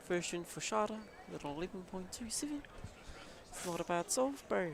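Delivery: hammed-up Kiwi accent. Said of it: "sounds like you're a Kiwi talking about fish".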